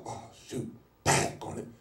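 whispered oh shoot, hurried; whispered kid's alarm; panicked whisper. Retold or imitated. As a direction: hiss it under your breath like a kid caught late. Kid panics at four thirty.

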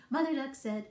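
ordinary delivery

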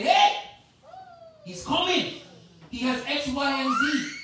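high, loud excited warning cry; urgent warning. Acted. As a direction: call out the danger. God warning the believer.

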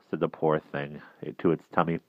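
plain reading voice

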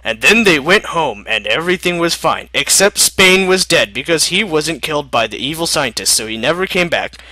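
ordinary delivery

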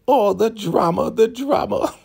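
high, theatrical imitation of scorn; hammed-up mock dismay; mocking exclamation. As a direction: cry it out in a put-on high voice. Imitates elder mocking the victim's wailing.

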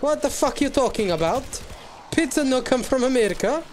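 high, loud, outraged Italian caricature; hammed-up indignant outburst with an Italian accent; Gino, mock angry. Imagined Italian storming in over pizza, mock angry. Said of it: "imagine Gino flipping coming in and being angry".

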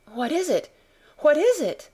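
high, urgent, rising alarm; alarmed gasp. A narrator's reading. Frightened mother hearing wailing break out, gasping in alarm. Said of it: "Missus Lennox gasped".

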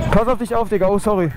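usual speaking voice

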